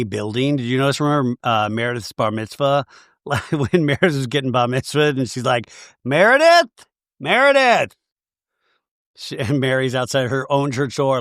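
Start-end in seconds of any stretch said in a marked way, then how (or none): 6.0-7.9 high, loud imitation of calling someone; mock calling out. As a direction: holler the name, pitched way up. Voicing Mary calling for Meredith.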